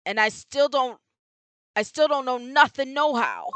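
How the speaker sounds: loud, gruff street tough refusing to talk; tough-guy boxer voice. Acted. Streamer voicing the boxer witness, loud tough guy. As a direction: play the stubborn palooka who won't rat.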